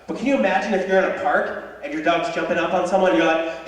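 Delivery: ordinary talk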